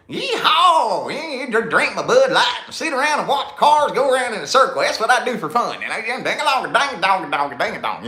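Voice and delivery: high, loud hammed-up hillbilly. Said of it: "the stereotype".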